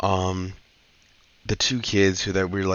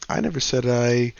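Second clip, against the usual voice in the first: normal talking voice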